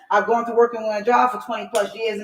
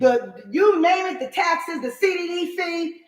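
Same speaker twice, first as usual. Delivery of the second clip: exasperated rant; 7 semitones higher than usual. Real, not acted. Ranting about pile of bills.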